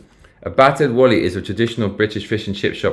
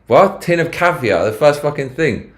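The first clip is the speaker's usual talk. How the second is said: shocked; 7 semitones higher, 3 dB louder than usual. Real, not acted. Shocked at caviar price.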